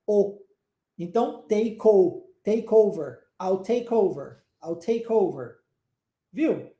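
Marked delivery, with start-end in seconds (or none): 1.5-5.6 "take o-" building to "I'll take over", K joined to "o"; linked words. Shown as a demonstration. Teacher builds up "I'll take over" from the linked sound, linked words. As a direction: say "take over" as "tay-kover", falling at the end. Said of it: "E over começa com o som de uma vogal".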